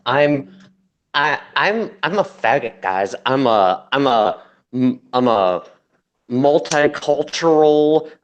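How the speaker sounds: slow, drawn-out mocking lisp; hammed-up lisp, dragging each word; lisping Maza impression. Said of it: "trying to talk with a lisp just to sound like Maza".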